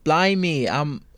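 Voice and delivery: loud, slow, hammed-up British accent; put-on British accent. [name] showing his best accent on request, broad British. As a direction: play a broad cartoon Brit, drawn out. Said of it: "[name], what's your accent?".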